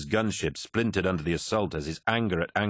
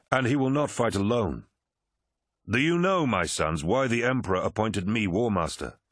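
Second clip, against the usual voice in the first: raised, challenging warlord voice; commanding Horus; 3 semitones higher than usual. Acted. Horus tests his officers, commanding. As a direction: put a pointed question to your sons.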